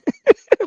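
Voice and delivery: short high-pitched laugh; quick, high burst of laughter. Real, not acted. Host amused by a viewer's comment, short high laugh.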